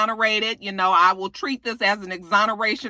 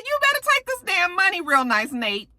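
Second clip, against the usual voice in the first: insistent; 10 semitones higher than usual. Retold or imitated. Urging Nate to take money.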